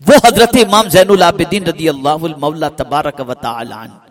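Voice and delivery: ordinary delivery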